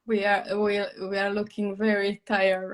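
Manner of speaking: ordinary talk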